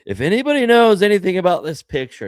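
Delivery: high, loud, fast mock broadcast appeal. Mimics national television appeal to viewers.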